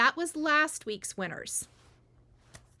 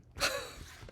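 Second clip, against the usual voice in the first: short breathy laugh; 8 dB quieter than usual. Real, not acted. Exasperated laugh about scammers.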